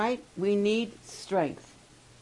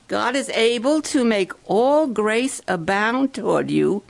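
louder, proclaiming Bible verse; preacher rereads the verse louder for the campers; emphatic scripture reading; 6 dB louder than usual. Shown as a demonstration. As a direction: declaim the verse so they can repeat it. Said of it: "Now listen".

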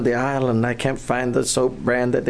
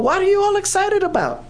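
put-on high, fast teasing girlfriends' voice; mocking girls; faster than usual. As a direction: squeal it like a gaggle of scoffing friends. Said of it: "they're all".

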